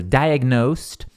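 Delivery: "Diagnosed" ending in a crisp T, like diagnost; final T sound. Shown as a demonstration. Teacher shows students the final T sound. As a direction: say "Diagnosed" with a T at the end, no D. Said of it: "Tem som de T aqui no final".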